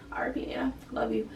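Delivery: soft whispered aside to late Nana; whispered tribute. Real, not acted. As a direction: drop to a hushed whisper, tender.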